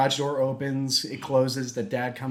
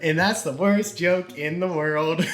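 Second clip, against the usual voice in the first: slow, laughing; chuckling through it; laughing admission; 5 semitones higher, 4 dB louder than usual. Real, not acted. Teller admitting it's the worst joke, laughing.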